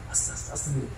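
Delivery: hushed, barely voiced whisper; conspiratorial whisper; secret whisper. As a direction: whisper so nobody hears. Brothers sneaking, whispering to each other.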